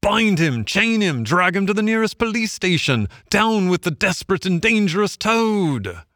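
high, outraged cry of the party; the party turning on Toad; angry cry. A narrator's reading. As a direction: cry out to grab him. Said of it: "they cried".

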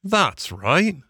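high, polite gentleman voice; an obliging motorist gentleman. A narrator's reading. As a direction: pitch him up as a kindly gentleman. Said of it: "said the gentleman".

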